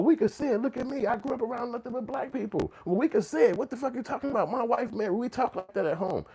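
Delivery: high-pitched mocking protest, held up throughout; imitated defensive excuse. Mimicking outsiders insisting they can say it, high and defensive. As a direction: mimic an indignant self-justifier. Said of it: "They get to say".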